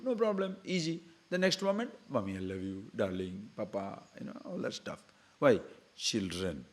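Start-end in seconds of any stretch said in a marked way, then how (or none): 2.1-4.0 put-on softer, cooing little child's voice; a hint of a sugary child; mock sweet child. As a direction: sweet-talk them like a fickle child.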